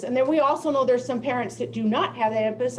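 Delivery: normal talking voice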